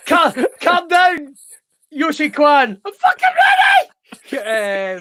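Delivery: high, slow, strained mock ninja cry; hammed-up movie ninja shouting. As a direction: play an overexcited movie ninja.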